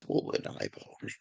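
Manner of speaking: very soft, slow whisper; whispered aside. Pretend. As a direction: whisper it slowly, almost to yourself. Odd aside during the game.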